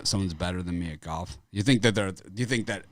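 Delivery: ordinary talk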